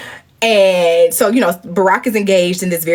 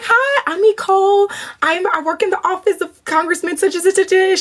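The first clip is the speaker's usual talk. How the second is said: high, chirpy self-introduction; starstruck, high-pitched politeness; eager introduction; 11 semitones higher than usual. Acted. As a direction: introduce yourself in a hammed-up high voice. Acting out her eager introduction.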